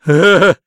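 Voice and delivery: short mocking chuckle; boastful laugh. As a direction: laugh down at the tiny ants. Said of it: "Rise il pachiderma sbruffone". (risata sbruffona)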